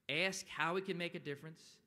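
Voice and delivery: ordinary delivery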